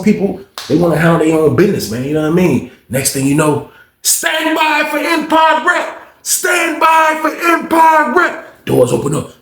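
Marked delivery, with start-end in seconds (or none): 4.0-8.6 long drawn-out shouted announcement, much higher; guard's call. Retold or imitated. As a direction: call it out slowly over the jail speaker. Jail intercom calls the unit.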